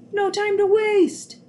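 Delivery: urgent warning shout; urgent cry. Acted. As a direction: Nick hurrying his siblings in before the robot comes. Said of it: "cried Nick".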